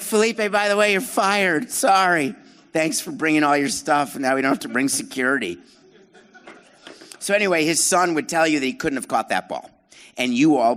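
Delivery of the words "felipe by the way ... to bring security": high, loud-ish comic self-quote; mock breezy firing. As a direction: toss off a firing as an afterthought, pitched up. Comic retelling of firing a manager.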